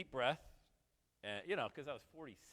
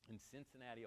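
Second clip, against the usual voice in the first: ordinary talk, nothing marked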